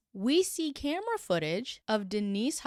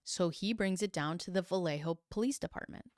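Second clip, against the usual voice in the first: normal talking voice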